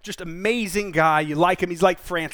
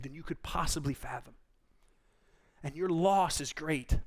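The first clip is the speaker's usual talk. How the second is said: gracious; 8 dB quieter than usual. Retold or imitated. God speaking graciously to Job about his loss, softly. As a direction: gently comfort a grieving man. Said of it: "I think super graciously".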